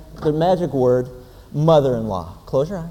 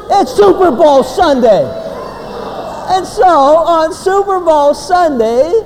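loud, high, drawn-out hype; rousing announcement; 11 semitones higher, 6 dB louder, slower than usual. Acted. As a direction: proclaim it big and slow to a crowd. Preacher hypes up the crowd.

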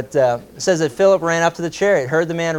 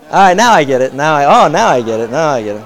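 laughing realization; 7 dB louder than usual. Real, not acted.

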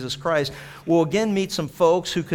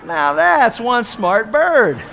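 laughing; 7 semitones higher, 8 dB louder, much slower than usual. Real, not acted. Laughing after the punchline.

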